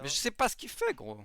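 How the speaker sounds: high, loud exasperated outburst. Real, not acted. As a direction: burst out in disbelief. Exasperated at teammate.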